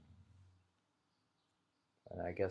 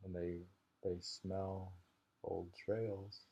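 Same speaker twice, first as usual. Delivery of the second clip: ordinary talk, nothing marked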